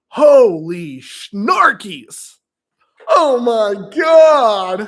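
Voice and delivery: slow, loud shocked cry; shocked shout. Retold or imitated. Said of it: "And then I'm like".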